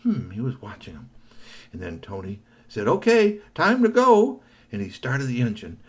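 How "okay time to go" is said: high, loud announcement; cheerful vendor. Acted. Vendor starting up, high loud call. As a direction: call it out brightly.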